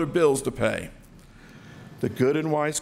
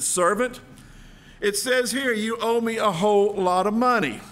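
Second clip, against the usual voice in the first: a king's stern, raised voice; a louder ruler pressing his debtor; 6 semitones higher, 3 dB louder than usual. Acted. King confronts servant over debt.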